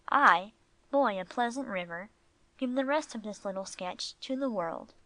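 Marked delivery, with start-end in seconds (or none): none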